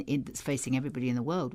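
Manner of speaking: ordinary talk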